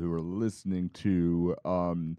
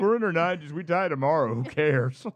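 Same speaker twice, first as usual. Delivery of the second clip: put-on old lady voice; 7 semitones higher, 4 dB louder than usual. Old ladies voiced in story.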